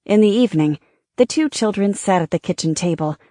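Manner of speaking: usual speaking voice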